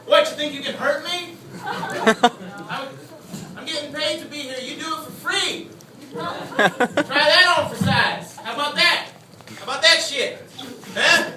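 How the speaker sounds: high-pitched cocky challenger; put-on taunting voice. Comedian taunting back at the crowd, put-on cocky voice. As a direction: goad them in a voice pitched well above your own. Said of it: "I don't really talk like that".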